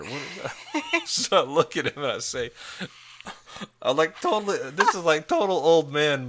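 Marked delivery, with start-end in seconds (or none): none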